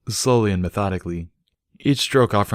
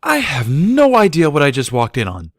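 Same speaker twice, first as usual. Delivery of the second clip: higher, louder embarrassed protest; exasperated filly; 8 semitones higher, 7 dB louder than usual. A narrator's reading. Embarrassed filly complaining to her boyfriend. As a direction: voice her mortified groan.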